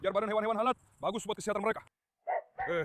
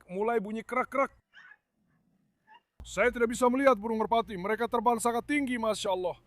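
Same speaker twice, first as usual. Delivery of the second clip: ordinary talk, nothing marked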